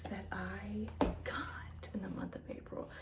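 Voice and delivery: hushed, secretive whisper; whispered announcement. Real, not acted. Whispered transition to new decks.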